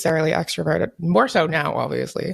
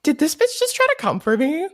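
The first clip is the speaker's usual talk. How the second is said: indignant; 9 semitones higher than usual. Retold or imitated. Indignant retold reaction.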